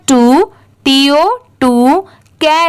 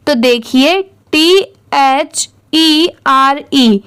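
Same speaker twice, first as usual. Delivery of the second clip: normal talking voice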